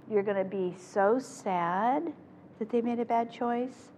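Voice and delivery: ordinary talk, nothing marked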